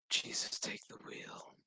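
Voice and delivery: barely audible whispered plea; whispered mock prayer. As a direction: whisper it like a helpless aside to heaven. Girl's silent prayer, retold.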